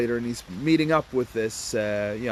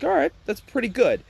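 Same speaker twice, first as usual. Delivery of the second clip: higher, squeaky mock voice; mock high Barry; 9 semitones higher than usual. Barry pleased with the costs, in a higher mock voice. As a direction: squeak out his approval.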